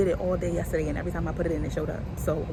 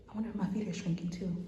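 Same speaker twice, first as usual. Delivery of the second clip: ordinary talk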